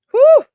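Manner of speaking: loud, high-pitched whew; swooning whew. Acted. Streamer swooning over bad boys, loud high whew. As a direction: let out a big, high whew.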